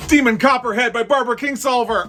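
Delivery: the favourite wins, let it burst out; overjoyed shout. Real, not acted. Hearing her favourite book win, a high joyful cry.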